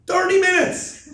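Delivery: very high, loud, stretched-out exclamation; sudden drawn-out high holler; exasperated shout. Real, not acted. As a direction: yell it in comic disbelief. Exasperated at length of tearful plea.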